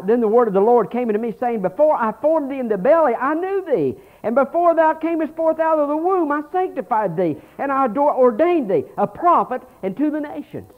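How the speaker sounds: loud, high fervent scripture reading; preaching scripture reading. Acted. Pastor reading Jeremiah aloud to his church, fervent preaching. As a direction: proclaim the verses to the congregation. Reads Jeremiah at full force.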